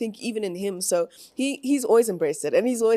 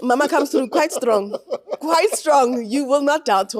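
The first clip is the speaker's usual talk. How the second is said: emphatic; 7 semitones higher, 6 dB louder than usual. Real, not acted. Describing strong mother.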